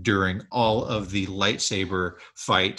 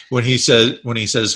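ordinary talk, nothing marked

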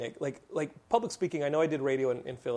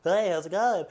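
lightly voiced nervous freshman greeting; 5 semitones higher, 6 dB louder than usual. Freshman greeting a girl he likes, lightly voiced. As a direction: play your shy younger self saying hi. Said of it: "be like".

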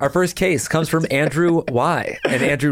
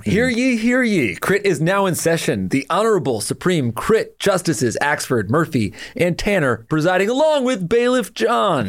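town crier. Acted. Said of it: "so strong, that Bailiff John".

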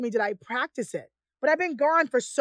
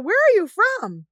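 mock surprised question; 7 semitones higher, 6 dB louder than usual. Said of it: "they were like".

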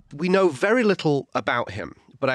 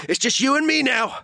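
high-pitched mock film hero; hammed-up showdown delivery; action-movie showdown line; 9 semitones higher than usual. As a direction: act out a climactic face-off, pitched up. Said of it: "one of the characters going".

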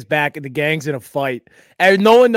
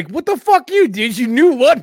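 high, loud disbelieving outburst; shocked outburst; 10 semitones higher, 8 dB louder than usual. Real, not acted. Friend asks his life goal, he blurts out shocked. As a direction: yelp it out in stunned disbelief.

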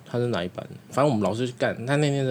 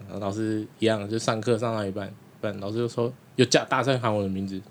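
normal talking voice

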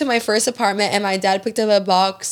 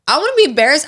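usual speaking voice

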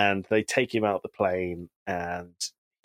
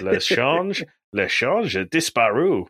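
mock French; 6 semitones higher, 3 dB louder, slower than usual. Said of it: "he goes".

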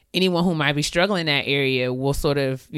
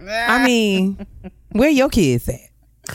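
sassy; 6 semitones higher, 4 dB louder, much slower than usual. Retold or imitated. Sassy comeback.

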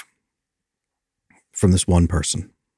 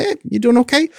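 gentle check-in; 14 semitones higher than usual. Retold or imitated. Helper checking on a nervous client on the bus, high and caring. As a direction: check on them kindly, lifted up high. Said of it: "I'd say".

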